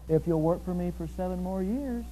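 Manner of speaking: low, soft father-in-law's reply; Laban unruffled. Acted. Laban offers Jacob a second bargain, low and calm. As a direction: answer the outburst quietly, setting terms.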